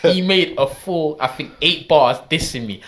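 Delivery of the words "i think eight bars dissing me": ordinary delivery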